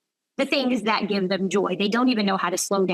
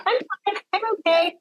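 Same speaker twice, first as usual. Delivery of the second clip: higher-pitched mock denial; brushing it off; 11 semitones higher than usual. Burnt-out doctor brushing off a worried colleague, high-pitched denial. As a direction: imitate someone insisting they're fine. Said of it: "They're like".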